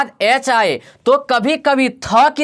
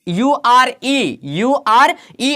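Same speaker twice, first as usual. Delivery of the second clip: slow spelling; much slower than usual. Shown as a demonstration. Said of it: "उसके just बाद यूआरए".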